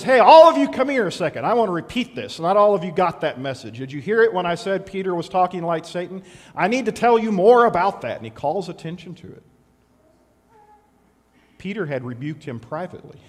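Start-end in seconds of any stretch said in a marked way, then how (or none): 0.0-8.2 louder, higher call to the crowd. Retold or imitated. Jesus calling the crowd and disciples to hear him, loud and insistent.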